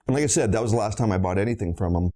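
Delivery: ordinary talk, nothing marked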